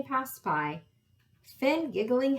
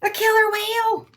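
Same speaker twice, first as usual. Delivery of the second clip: high, loud cry of alarm; alarmed shout; 13 semitones higher, 8 dB louder than usual. A narrator's reading. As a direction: shout out the danger in shock. Character spots a killer whale.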